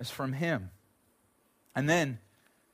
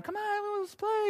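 put-on much higher, sing-song little girl coaxing; high, softer little girl voice begging to play; little daughter; 5 dB quieter, slower than usual. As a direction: coax your brother like a small child. Voicing his little daughter.

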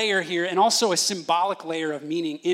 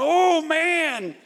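high, drawn-out mock whine; imitated crying; 9 semitones higher, 5 dB louder than usual. Dad imitating the older brother after three whacks, still crying. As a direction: imitate an older kid still wailing, pitched way up. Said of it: "Ross is still crying. He's going".

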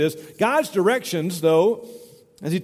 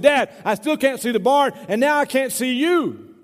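higher-pitched mock holler, stretching the end; imitated calling out; 7 semitones higher than usual. Farmer's son calls to his father from the mailbox, mock holler. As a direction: play a son calling back to Dad.

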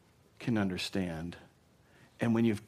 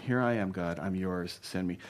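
quiet surrender; 5 semitones lower, 4 dB quieter than usual. Acted. Voicing Isaiah's surrender.

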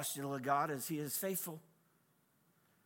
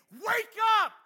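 much higher and louder yell; panicked shout; 13 dB louder than usual. Retold or imitated. Sailors screaming at sleeping Jesus, panicked shout. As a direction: scream it like sailors about to drown.

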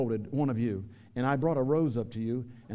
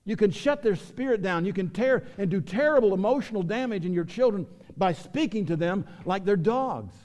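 raised, pressing pulpit voice; preaching at full force; 6 semitones higher, 6 dB louder than usual. Acted. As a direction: pastor urging the congregation to protect their children, preaching hard. Pastor preaching against child abuse.

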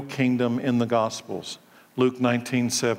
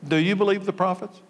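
ordinary delivery

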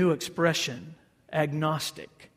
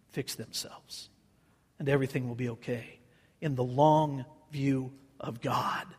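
whispered prayer; 4 semitones lower, 7 dB quieter than usual. Acted. Praying to the Lord for peace, a hushed whisper. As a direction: breathe the prayer out quietly.

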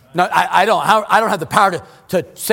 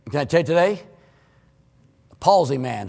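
ordinary talk, nothing marked

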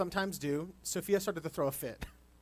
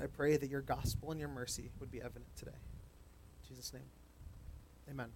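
softer, reverent prayer voice fading out; 5 dB quieter than usual. Real, not acted. Pastor praying for the congregation, soft and reverent. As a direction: pray quietly and earnestly.

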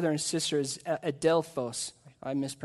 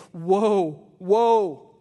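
Jesus warning; 6 dB louder than usual. Acted. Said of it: "And Jesus is saying".

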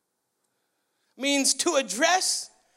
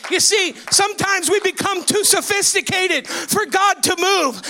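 loud, driving revival preaching; full-force preaching; 4 semitones higher, 5 dB louder than usual. Real, not acted. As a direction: cry it out to the congregation, stretching key words.

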